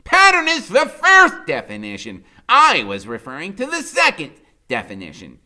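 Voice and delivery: high, loud exasperated lecture; exasperated Freak. A narrator's reading. Disgusted Freak explaining archetypes, high loud. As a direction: scold him then explain, high and loud. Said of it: "he goes".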